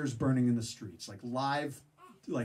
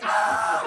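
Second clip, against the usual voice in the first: mock scream; 11 dB louder than usual. Soldiers screaming.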